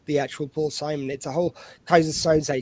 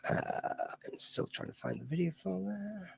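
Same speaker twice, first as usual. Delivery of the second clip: usual speaking voice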